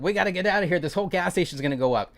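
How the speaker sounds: high, loud, rushed panic; panicked coworker. Retold or imitated. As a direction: sell the coworker's alarm, fast and loud. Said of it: "was freaking out".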